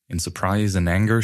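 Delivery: ordinary delivery, nothing marked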